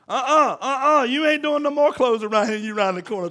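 loud, high-pitched protest; alarmed scolding wife. Acted. Wife banning husband from laundry, loud alarmed scolding. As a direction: cut him off with loud, high uh-uhs.

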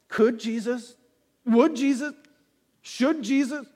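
"Could", "Would", "Should" each lifted high at the start; stress on the first word. Acted. Rhetorical build on Jesus' power.

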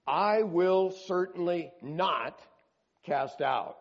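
slow, weighted I will certainly not; emphatic promise. Acted. Jesus promising the one who comes, emphatic. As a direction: lean hard on each word of I will certainly not. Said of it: "and then he gets emphatic".

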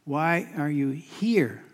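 slow, deliberate question landing on a stretched "here"; pause, then press on the word "here"; stress on HERE. Shown as a demonstration. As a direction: draw out "here" at the end of the question. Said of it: "the emphasis on that word "here".

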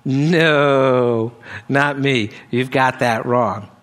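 low, drawn-out comic disclaimer; mock modest denial. Preacher imagining his own reply to Jesus, mock modest denial. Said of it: "I would say".

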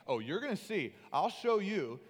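loud, rising mock condescension; condescending. God mocked up as talking down to Moses, condescending. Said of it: "saying that in a condescending tone".

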